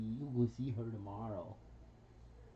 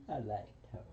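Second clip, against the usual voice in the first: softer, lower, a light copy of the parrot saying it; mimicking the parrot; 5 semitones lower, 5 dB quieter, faster than usual. Owner copying how the parrot says it, lightly mimicking. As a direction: echo the bird's little phrase back to it, quietly. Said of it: "the way you say it like that".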